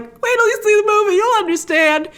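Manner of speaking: high, pleading quoted self; tearful insistence. Retold or imitated. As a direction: urge them to just wait and see. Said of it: "And I'm like".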